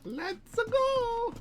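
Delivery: very high cartoon let's go; Mario voice. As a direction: chirp it like Mario.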